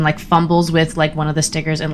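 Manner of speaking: usual speaking voice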